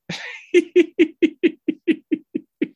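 big squealing laugh; high burst of laughter. Real, not acted. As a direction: crack up at the punchline. Laughs at own story.

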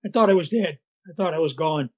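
normal talking voice

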